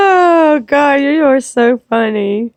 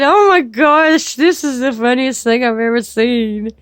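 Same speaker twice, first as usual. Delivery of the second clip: ordinary talk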